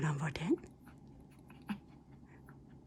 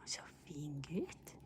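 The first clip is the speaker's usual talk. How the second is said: whisper; 2 semitones lower, 13 dB quieter than usual. Real, not acted. Soft praise to the dog.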